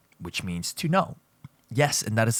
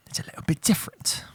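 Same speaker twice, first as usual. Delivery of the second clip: whisper; 6 semitones higher than usual. Real, not acted. Lesson line said unvoiced.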